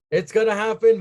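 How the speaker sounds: gleeful disbelief; excited repeat. Retold or imitated. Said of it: "I was like".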